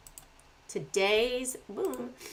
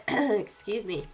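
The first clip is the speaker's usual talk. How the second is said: ordinary talk, nothing marked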